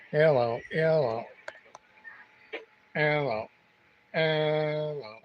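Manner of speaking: ordinary delivery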